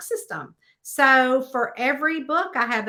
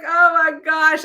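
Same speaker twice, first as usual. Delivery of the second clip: raised, loud gushing exclamation; retold amazement; 6 semitones higher, 7 dB louder than usual. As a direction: re-enact being thrilled and astonished. Retold reaction to meeting a reader.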